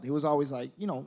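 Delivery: usual speaking voice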